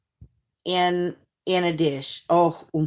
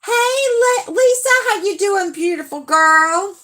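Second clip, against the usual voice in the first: bright, much higher sing-song hello; excited greeting; 11 semitones higher, 3 dB louder than usual. Real, not acted. Greeting viewer Lisa in the chat, excited and high. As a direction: call out to her like a friend you're thrilled to see.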